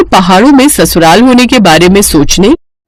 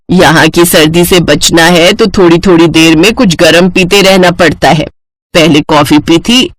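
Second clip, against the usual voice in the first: lower, measured elder's voice; instructing mother-in-law; 5 semitones lower than usual. Acted. Mother-in-law explains Kashmiri habits to bride, instructing. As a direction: lay down household routine kindly.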